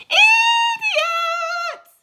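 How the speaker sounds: high, loud insulting yell; centipede yelling. Acted. Centipede yelling insults at the cloud men. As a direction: hurl the insult across the sky. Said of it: "he yelled".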